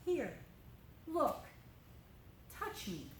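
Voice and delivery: soft, gentle reassurance; Jesus softly reassuring doubting Thomas; tender. Retold or imitated. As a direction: speak softly, as if comforting a friend. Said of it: "Jesus walked up to Thomas and said".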